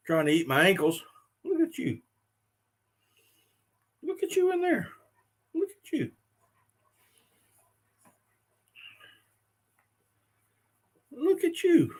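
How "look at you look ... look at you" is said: very high soft pet voice. Real, not acted. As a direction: coo at the little puppy. Baby talk to the puppy.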